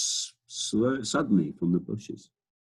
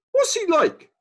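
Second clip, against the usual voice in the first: much higher, squeaky giraffe; 7 dB louder than usual. Acted. Giraffe asking the pelican about the Duke, much higher and curious. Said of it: "the giraffe asked".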